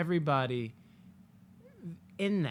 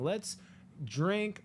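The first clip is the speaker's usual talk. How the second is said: usual speaking voice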